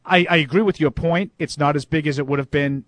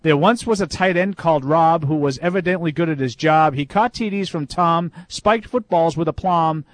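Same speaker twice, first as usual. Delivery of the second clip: limerick reading; 3 semitones higher, 4 dB louder than usual. Acted. Reads listener's limerick aloud.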